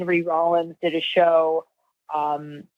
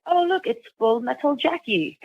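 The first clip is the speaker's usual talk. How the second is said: higher-pitched mock British accent; bad British accent; 6 semitones higher than usual. Radio host spotting a guest in the studio, bad British accent. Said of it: "that's a bad British accent".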